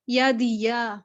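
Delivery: normal talking voice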